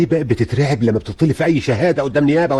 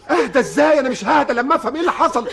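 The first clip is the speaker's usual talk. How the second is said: won't calm down until he knows; frantic father; 12 semitones higher than usual. Acted. Father frantically demanding answers from the officer, high and upset.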